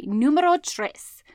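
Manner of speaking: slow, hammed-up Spanish accent on numero tres; playful Spanish. Podcaster counting off tip three to listeners, mock Spanish flair. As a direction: roll it out like a game show host in Spanish.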